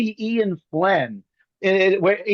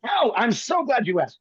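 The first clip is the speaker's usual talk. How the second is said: mock surprise; 3 semitones higher than usual. Echoing the question with mock drama.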